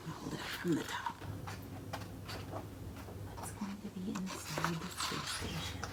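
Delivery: very soft hushed aside; whisper. Real, not acted. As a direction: whisper while lifting the light. Peeking with light.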